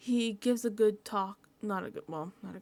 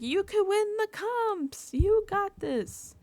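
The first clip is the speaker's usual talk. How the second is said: mock encouragement; 10 semitones higher, slower than usual. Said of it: "Vince is like".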